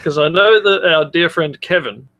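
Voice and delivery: normal talking voice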